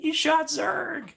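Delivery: high, slow, astonished character voice; drawn-out high disbelief; high slow mock Buzz; mock cartoon voice. Buzz figure voiced in acted quote.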